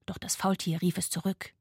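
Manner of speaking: ordinary delivery, nothing marked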